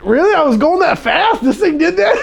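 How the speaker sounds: high, loud, laughing disbelief; laughing mock amazement at his own speed; surprised disbelief. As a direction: play innocent shock, half laughing. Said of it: "I was so surprised".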